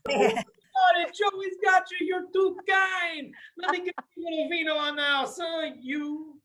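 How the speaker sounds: schmooze the manager as Joey; over-the-top wiseguy charm. Pretend. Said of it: "I'm like".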